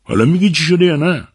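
loud, high-pitched demand; yelled, insistent question; impatient shout. Acted. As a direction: shout it sharply at the boy, pitch way up. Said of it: "سباستیان داد زد".

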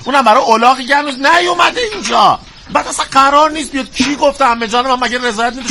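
high-pitched exasperated complaint; indignant protest. Acted. Indignant complaint about neighbours' protest.

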